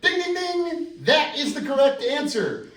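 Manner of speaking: high, loud game-show host cheer; game-show ding. Acted. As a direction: announce a winner with glee. Game-show style correct answer call.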